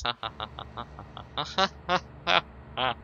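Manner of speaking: spoken ha ha laugh; mock laugh. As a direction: laugh it out like a taunt. Mocking laugh at dead enemy.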